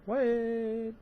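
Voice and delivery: sudden high, startled question; surprised what. Real, not acted. Reader breaking off to react to the book, surprised. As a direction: caught off guard by what she just read.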